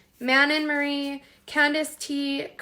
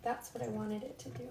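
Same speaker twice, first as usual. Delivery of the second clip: quiet self-talk, half off to the side; talking to oneself; 14 dB quieter than usual. Real, not acted. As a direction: murmur it as if to yourself. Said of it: "I'm just talking to myself over here".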